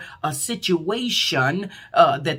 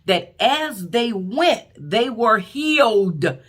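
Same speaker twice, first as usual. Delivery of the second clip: preaching emphasis; 4 semitones higher, 4 dB louder, much slower than usual. Acted.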